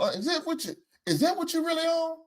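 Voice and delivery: high quiet is-that-what-you're-on; incredulous. Real, not acted. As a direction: ask what he's on, amazed. Incredulous question about drugs.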